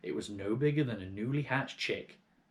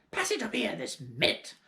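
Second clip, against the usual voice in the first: high, sneering hag voice; accusing old hag; 12 semitones higher than usual. Acted. Grandma accusing George of selfishness, high sneering hag. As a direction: jeer at the boy from above.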